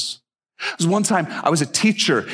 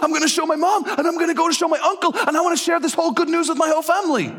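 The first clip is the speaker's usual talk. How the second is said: high-pitched, elated rush of words; excited student; 14 semitones higher, faster than usual. Retold or imitated. Student discovering he can budget, bursting with excitement. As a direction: gush high, speeding up with excitement. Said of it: "he went".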